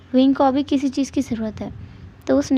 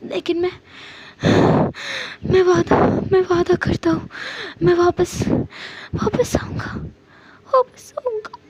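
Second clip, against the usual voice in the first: higher, breaking voice ending in a sob; crying promise; 5 semitones higher than usual. Acted. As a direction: cry out the promise as you break. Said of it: "उसकी आंखों में आंसू थे". (रोते हुए ऊंची टूटती आवाज़ में वादा)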